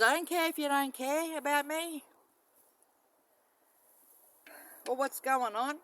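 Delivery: defiant heated rant; heated outburst. Real, not acted. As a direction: let the frustration burst out. Vents about being ignored.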